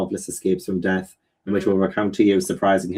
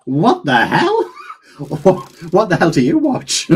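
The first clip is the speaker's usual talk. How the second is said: high, loud laughing what the hell; laughing at the friend's guess; laughing disbelief; 7 semitones higher, 3 dB louder, slower than usual. Real, not acted. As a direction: crack up in disbelief.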